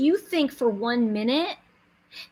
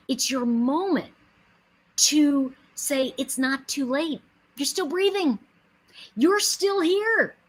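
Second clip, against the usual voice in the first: higher and louder, slow, building like a preacher; building sermon; 6 semitones higher, 5 dB louder, slower than usual. Acted. Urging the audience that it isn't too late, slow, rising and louder. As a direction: lift them up, slower, rising and louder.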